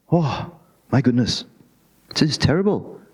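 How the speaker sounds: usual speaking voice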